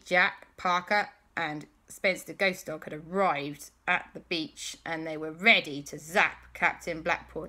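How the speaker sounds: ordinary delivery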